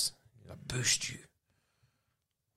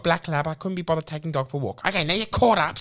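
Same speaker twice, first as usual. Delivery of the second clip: usual speaking voice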